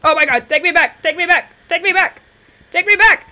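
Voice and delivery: loud, high frantic begging; panicked freak-out. Real, not acted. Gamer begging a glitching game to take them back, panicked. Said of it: "Sorry for freaking out there".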